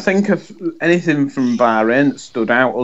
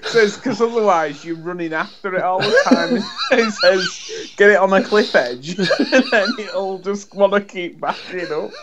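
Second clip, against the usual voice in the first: higher-pitched, giggling retelling; laughing while talking; 5 semitones higher than usual. Real, not acted. Co-host retells Billy Connolly joke, real laughter breaking in. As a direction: struggle through the joke laughing.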